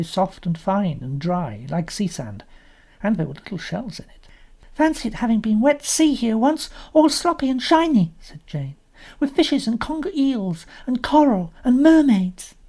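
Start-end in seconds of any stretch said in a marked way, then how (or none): 4.7-8.1 put-on high, wondering child voice; dreamy little girl. 9.2-12.5 put-on high, wondering child voice; dreamy little girl. Jane continues.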